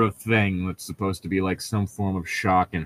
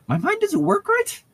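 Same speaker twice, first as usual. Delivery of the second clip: "right" pitched up into a high, squeaky question; much higher questioning voice. Shown as a demonstration. Host rereads the line as Bella's question, high falsetto. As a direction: ask "my mind doesn't work right?" in a high falsetto with a rising end. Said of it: "She asked".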